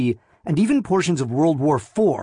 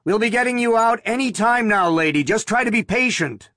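higher, raised voice calling down; yelled; 9 semitones higher than usual. A narrator's reading. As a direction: call out to someone below the ground. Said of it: "Officer Tinbane yelled".

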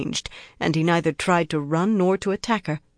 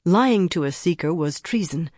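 usual speaking voice

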